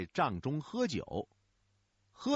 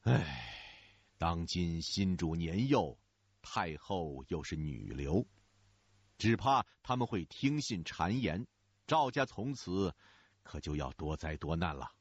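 低沉缓慢的叹息腔; 一口长叹，慢慢道出忧虑; 深深叹气; 7 semitones lower than usual. A narrator's reading. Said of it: "又叹了一口气说". (低沉缓慢地叹气说)

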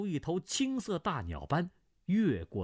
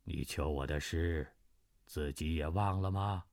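lower, cold voice of a stranger; cold old man; 7 semitones lower than usual. Acted. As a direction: speak down to her, coldly. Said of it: "只听那青袍客道". (低沉冷淡的青袍客)

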